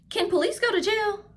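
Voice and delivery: put-on high, quick child voice; little boy's question. Josh asking his mother about jail, high child voice. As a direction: pitch up like a worried small boy.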